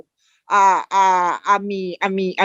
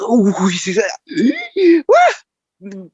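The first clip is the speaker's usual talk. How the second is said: a breathless cry of exhaustion; mock gasping for air; much slower than usual. Out of breath in the first recording days, mock gasp. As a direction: play it like you've run out of air. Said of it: "Tráiganme oxígeno".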